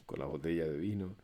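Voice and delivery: ordinary delivery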